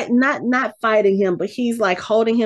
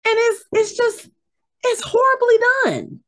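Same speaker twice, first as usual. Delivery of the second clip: squeaky, much higher exasperated voice; incredulous high-pitched complaint; pitched way up in disbelief at how bad it is. Real, not acted. Ranting about an irritating accent.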